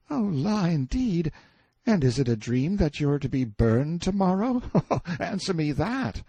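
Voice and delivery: high, mocking boy with a laugh; light-hearted teasing boy. A narrator's reading. Boy laughs at the prisoner's sorry plight, teasing. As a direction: make merry fun of him in a high voice. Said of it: "he only laughed in his light-hearted way".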